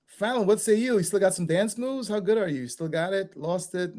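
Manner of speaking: normal talking voice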